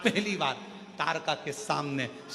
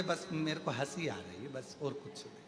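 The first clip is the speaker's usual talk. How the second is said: softer, brushing it off; quiet evasive reply; 2 semitones lower, 9 dB quieter than usual. Retold or imitated. Tarka deflects Parvati's question, much softer. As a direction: hold back and murmur the answer.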